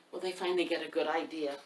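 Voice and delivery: ordinary delivery, nothing marked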